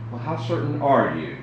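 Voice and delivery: ordinary talk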